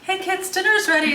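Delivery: put-on high, loud call to the kids; yelling across the room. Calling kids to dinner.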